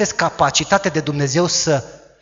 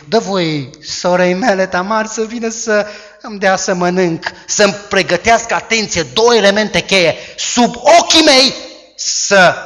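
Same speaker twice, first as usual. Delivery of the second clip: pleading Amnon; 6 semitones higher, 8 dB louder than usual. Acted. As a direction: wheedle like a spoiled prince faking illness. Amnon voiced in the retold story.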